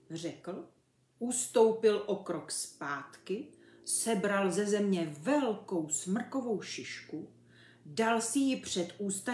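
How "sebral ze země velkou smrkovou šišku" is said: plain reading voice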